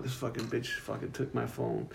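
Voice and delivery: thinking angrily about the clerk, hushed and soft; inner voice. Retold or imitated. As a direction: think it to yourself, barely voiced. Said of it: "in my head, I'm like".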